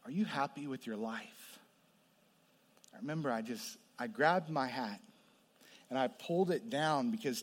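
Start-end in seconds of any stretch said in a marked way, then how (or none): 0.0-1.6 stranger's quiet question. Retold or imitated. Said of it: "He says".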